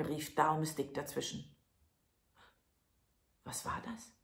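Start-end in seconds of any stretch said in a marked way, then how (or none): 3.4-4.1 frightened whisper; breathless, scared whisper; startled thief. Acted. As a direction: hiss it in sudden fear. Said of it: "sprach der eine Dieb erschrocken".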